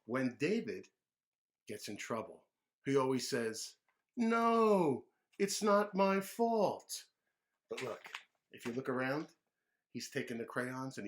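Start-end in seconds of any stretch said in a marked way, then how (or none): 4.2-7.0 put-on high, slow childish whine; dragged-out kid whine; whiny kid denial. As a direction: protest your innocence like a little boy. David denies blame.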